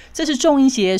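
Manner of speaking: ordinary talk, nothing marked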